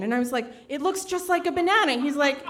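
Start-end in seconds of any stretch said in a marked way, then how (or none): 0.7-1.9 raised, insistent complaint; lightly hammed-up protest; mock indignant. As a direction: argue the obvious point. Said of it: "this screaming ... crazy pants fight".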